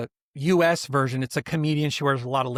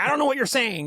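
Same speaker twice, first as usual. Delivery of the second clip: mom not hearing; 8 semitones higher, faster than usual. Retold or imitated. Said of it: "And she's like".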